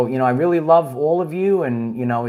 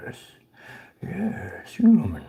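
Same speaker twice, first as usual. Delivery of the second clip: eerie alien whisper; breathy otherworldly whisper; creature whisper; 5 dB quieter, slower than usual. Acted. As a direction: hiss it like a creature addressing a human.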